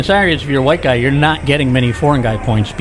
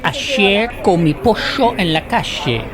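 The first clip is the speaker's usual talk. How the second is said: slow, higher Argentine Spanish, "pollo" as "posho"; Argentine Spanish accent; 7 semitones higher, slower than usual. Shown as a demonstration. Mocking his own try, in slow, higher Argentine Spanish. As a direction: show off a thick Argentine accent. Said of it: "very Argentine Spanish".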